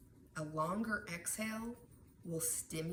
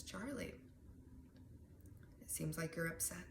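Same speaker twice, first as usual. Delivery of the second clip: soft, gentle teacher; 6 dB quieter than usual. Shown as a demonstration. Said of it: "might sound like this".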